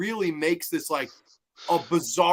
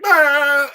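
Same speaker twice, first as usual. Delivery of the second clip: mock scream; 9 semitones higher, 9 dB louder than usual. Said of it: "she's like".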